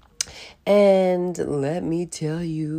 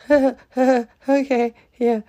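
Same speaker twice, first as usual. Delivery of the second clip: laughing in disbelief; nervous laughter; 7 semitones higher, 3 dB louder, much slower than usual. Real, not acted. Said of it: "one of those laughs".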